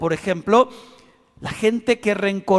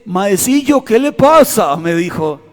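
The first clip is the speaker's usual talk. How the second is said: voz del peón, más alta y fuerte; imitando al peón; 8 dB louder than usual. Retold or imitated. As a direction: imita al peón con risa. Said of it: "pero me dice". (el peón burlón pregunta al niño)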